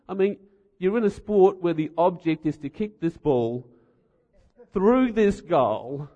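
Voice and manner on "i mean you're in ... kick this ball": usual speaking voice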